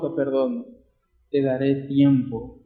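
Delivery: merciful king. Acted. The king softly pardoning his kneeling servant, gentle. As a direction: forgive him quietly, like a kind king.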